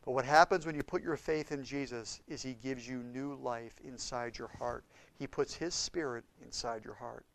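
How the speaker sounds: ordinary talk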